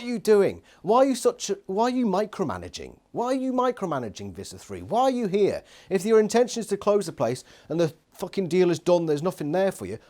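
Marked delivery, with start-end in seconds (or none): none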